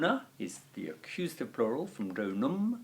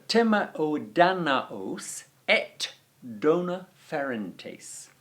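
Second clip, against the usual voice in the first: higher, very slow Latin reading; slow recitation; 5 semitones higher, 3 dB louder, slower than usual. Shown as a demonstration. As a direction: spell the line out slowly for students.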